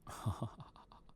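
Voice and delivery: brief voiceless chuckle, trailing off; quick string of fading ha-has; short laugh. Real, not acted. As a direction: let out a short breathy laugh. Amused after his jump attempt.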